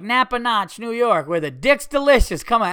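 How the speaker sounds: loud, high mock promo shout; hyped-up slogan. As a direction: sell the silly slogan to a laughing crowd. Retells riffing a slogan to crowd.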